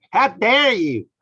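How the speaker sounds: high, loud scolding outrage; mock Greta's furious rebuke; Greta impression. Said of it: "Greta going".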